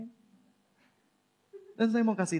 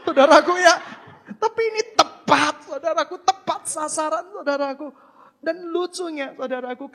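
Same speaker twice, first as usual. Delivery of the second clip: loud, high fervent preaching; preaching at full force; 13 semitones higher, 13 dB louder than usual. Acted. Preacher shouting excitedly to the congregation. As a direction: rouse the congregation with every line.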